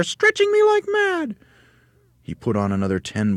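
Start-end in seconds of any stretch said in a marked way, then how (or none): 0.0-1.4 very high, loud excited squeal; Missus Twit. A narrator's reading. Wife feeling the balloons pull, high loud cry. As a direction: squeal it out urgently. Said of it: "cried Missus Twit".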